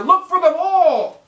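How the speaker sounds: high, loud, slow shout; exasperated yell. Real, not acted. Fan yelling at Bradbury to look for the ball, exasperated. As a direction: bark the order at him.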